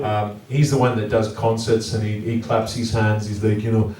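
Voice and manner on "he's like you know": ordinary delivery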